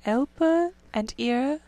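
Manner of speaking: slow, hushed verse recital; reciting a poem. A narrator's reading. Rat recites the reeds' song to the sleepy mole, slow and hushed. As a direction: intone the reeds' song slowly.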